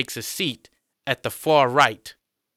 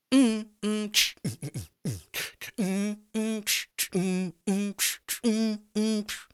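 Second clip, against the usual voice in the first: mock beatbox; 9 semitones higher, 4 dB quieter than usual. John mocks with mouth beats.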